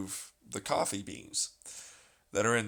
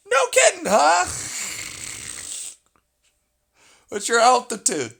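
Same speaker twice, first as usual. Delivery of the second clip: much higher and louder sarcastic squeal; sarcastic mock voice; 13 semitones higher, 8 dB louder than usual. Mocking the coffee instructions in a high sarcastic voice.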